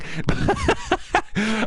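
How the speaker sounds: squeaky giggle fit; can't stop laughing at the joke; high-pitched tearful laugh; real high laughter. Real, not acted. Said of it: "almost crying".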